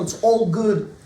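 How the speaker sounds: normal talking voice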